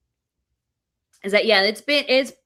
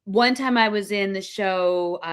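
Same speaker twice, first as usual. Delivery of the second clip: usual speaking voice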